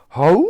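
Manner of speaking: rising, loud animal hoot; mammoth's trumpeting. A narrator's reading. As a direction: let out a big mammoth "hoo". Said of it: "let out a gigantic".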